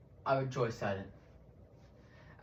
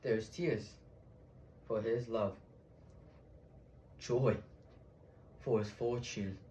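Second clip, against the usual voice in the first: hushed, solemn tribute to the dead; subdued oration; 14 dB quieter than usual. Acted. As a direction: drop the voice and measure each phrase. Acted speech, hushed after the climax.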